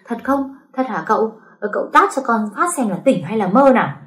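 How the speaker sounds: high, loud, jumping for joy; overjoyed whooping. A narrator's reading. Servant shouting with joy at his master's gift, high and loud. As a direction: shout it out, thrilled to be let off. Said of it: "nhảy tưng tưng ... hò hét lên".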